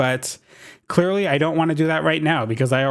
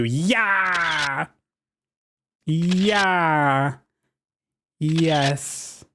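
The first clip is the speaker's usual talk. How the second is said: cheer; 2 semitones higher, much slower than usual. Real, not acted. Posing for a thumbnail photo, cheering. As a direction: cheer for the camera.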